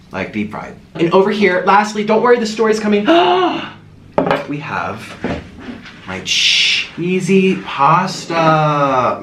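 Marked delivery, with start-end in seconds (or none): 3.1-3.7 high, excited gasp; sharp intake before the food reveal. Real, not acted. Host teases the next dish, excited gasp. 4.5-9.2 very slow, drawn-out relish; savoring. Real, not acted. Host presents the pasta to viewers, savoring it.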